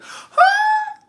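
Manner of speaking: short, very high horror scream; mock piercing shriek of terror; Ola Ray scream. Frightened girlfriend watching the transformation, imitated high scream. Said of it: "When Ola Ray was like".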